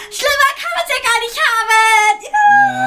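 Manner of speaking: dramatic wail. Real, not acted. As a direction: wail it like the end of the world.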